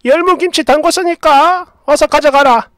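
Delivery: high-pitched ordering mother-in-law; bossy mother-in-law. Acted. Mother-in-law phones to order kimchi pickup, high bossy voice. 시모의 전화.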